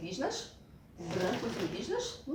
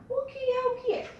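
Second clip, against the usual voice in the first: riddle call; 5 dB louder than usual. Acted. Teacher's riddle game call.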